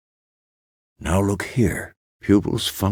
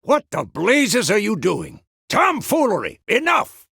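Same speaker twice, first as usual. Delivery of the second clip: high, irate outburst; exasperated scolding. Acted. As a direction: scold a reckless pupil from afar. Scolding someone for showing off.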